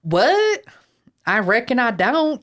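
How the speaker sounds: hammed-up Southern twang in feigned surprise; mock Southern accent. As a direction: play up a Southern drawl in mock denial. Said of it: "slight little Southern accent".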